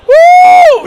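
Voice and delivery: big high whoop of delight; excited whoop. Real, not acted. Commentator whooping at a spicy game, excited. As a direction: let out a huge whoop at the play. Hype call after big play.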